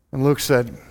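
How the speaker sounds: ordinary delivery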